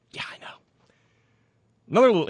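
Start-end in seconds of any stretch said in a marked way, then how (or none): 0.1-0.6 soft knowing whisper. Real, not acted. Quiet aside to the listener.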